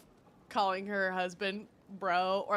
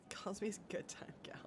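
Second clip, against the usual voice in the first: whispering; 12 dB quieter than usual. Real, not acted. Whispered aside to chat.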